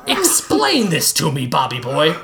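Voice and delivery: a hint of a sneering rival; lightly voiced snarl; menacing Pesto. Villain confronts Bob.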